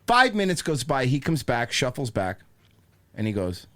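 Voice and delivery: usual speaking voice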